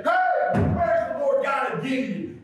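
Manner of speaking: high-pitched preacher's cry; impassioned calling out. Acted. Preacher models calling out to God.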